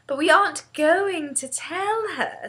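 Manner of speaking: put-on high-pitched, eager girl; child bubbling with an idea; excited. A narrator's reading. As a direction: reveal the plan with keen excitement. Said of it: "Sophie said excitedly".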